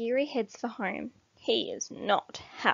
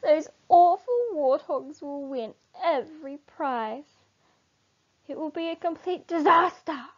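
dismay; 7 semitones higher, 4 dB louder than usual. A narrator's reading. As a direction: despair at a coming disaster. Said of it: "they cry in dismay".

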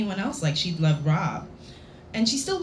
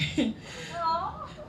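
light high giggle; a soft giggle escapes; real giggle; 10 semitones higher, 7 dB quieter than usual. Real, not acted. Reader laughs.

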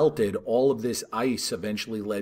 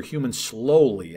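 slowly drawn out slow and louder; stress on slowly; 5 dB louder, slower than usual. Shown as a demonstration. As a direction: lean hard on slowly and stretch it. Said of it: "I emphasize the word slowly".

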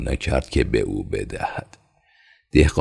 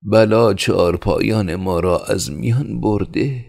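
high-pitched plaintive villager; mildly woeful, high voice; sighing lament; 8 semitones higher, 3 dB louder than usual. Acted. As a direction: lament the loss in a raised pitch.

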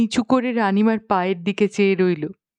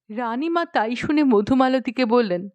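plain reading voice

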